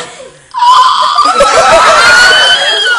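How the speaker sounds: very high loud laugh; squealing laugh. Real, not acted. Star asks to hear his voice, squealing laugh. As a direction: shriek with delighted laughter.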